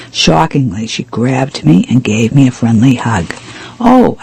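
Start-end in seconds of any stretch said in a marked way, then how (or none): 3.8-4.2 high, startled exclamation; exclaiming. A narrator's reading. Guest surprised by a sudden friendly hug, exclaiming. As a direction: let out a surprised, high-pitched oh. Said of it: "I exclaimed".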